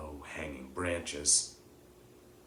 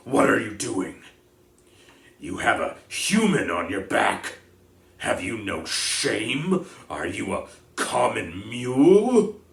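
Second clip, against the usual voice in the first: loud, scornful scolding, much louder; thundering rebuke; 4 semitones higher, 12 dB louder than usual. Acted. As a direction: shame him at full volume. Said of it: "Bane thundered".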